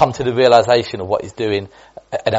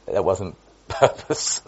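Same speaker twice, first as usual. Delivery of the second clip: ordinary talk, nothing marked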